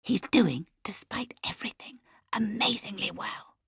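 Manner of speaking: intense, voiceless whisper; fierce whisper. A narrator's reading. Leah defending her father to the family, fierce whisper. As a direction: whisper it with force behind each phrase. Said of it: "lowering her voice to a fierce whisper".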